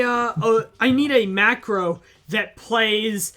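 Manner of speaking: ordinary talk, nothing marked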